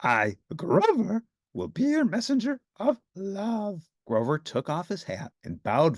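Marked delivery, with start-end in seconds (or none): 0.0-3.9 high, slow and grandly proud; grand proclamation. A narrator's reading. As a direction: announce yourself like a hero.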